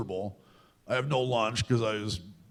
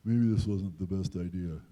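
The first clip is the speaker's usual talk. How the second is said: lower, doubtful inner voice; musing to himself, low and unsure; doubting inner voice; 6 semitones lower than usual. Retold or imitated. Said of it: "nights where I'm like".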